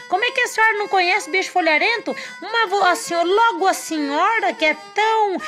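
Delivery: high, fast, fawning trickster; butter her up, high and quick; flattering monkey voice. Acted. Disguised monkey flatters the jaguar.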